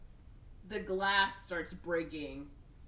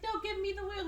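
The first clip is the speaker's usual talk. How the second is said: mock insistent; 13 semitones higher than usual. Acted fight over the controls.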